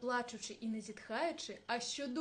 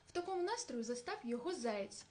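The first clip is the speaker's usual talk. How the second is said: plain reading voice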